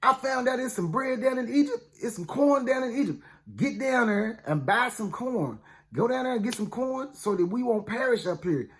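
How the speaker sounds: a hint of an old father hurrying his sons along; father ordering sons. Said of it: "he said".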